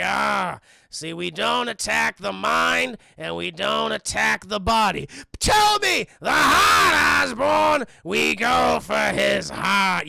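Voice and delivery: hammed-up evil scheming; shouting goblin. Goblin telling Norman to strike the heart, hammed-up evil shout.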